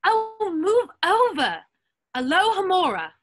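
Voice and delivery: high, loud, exasperated spell; impatient command. Acted. Hermione pushing past the boys to unlock the door, loud and high. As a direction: shove them aside and cast it.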